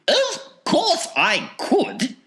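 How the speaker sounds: very high, slow falsetto; reply in a piping, stretched-out eccentric voice; Wonka's grand assurance. A narrator's reading. Wonka answering Mike.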